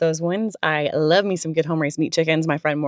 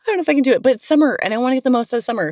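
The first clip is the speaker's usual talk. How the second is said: excited; 7 semitones higher, 4 dB louder, much faster than usual. Real, not acted. Hyping herself up for summer.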